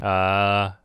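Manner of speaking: ordinary delivery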